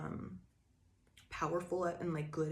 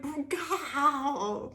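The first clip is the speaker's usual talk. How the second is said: laughing frustration; 9 semitones higher, 5 dB louder than usual. Retold or imitated. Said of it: "They're starting to get mad".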